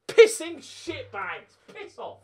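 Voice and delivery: high, loud, drawn-out swearing; raging at the opponents; slow furious curse. Real, not acted. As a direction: bite out each word in disgust. Cursing after the goal.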